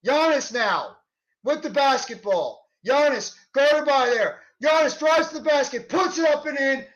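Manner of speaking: rising, excited call of each play; live play-by-play. Acted. Fan calling the Nets game play by play, excited. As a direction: call the action as it happens.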